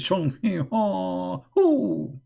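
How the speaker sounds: normal talking voice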